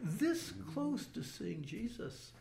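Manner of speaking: high-pitched, drawn-out comic regret; mock frustration. Survivor who nearly saw Jesus, mock frustration. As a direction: stretch it out high, as if just missing out.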